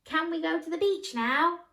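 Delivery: put-on high, excited kid asking; bright child's plea to go to the beach; eager child voice.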